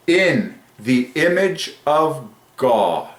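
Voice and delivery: very slow, each word held out; stately, stretched-out declaration; slow proclamation. Real, not acted. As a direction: draw out every word of the phrase. Proclaims the famous phrase in awe.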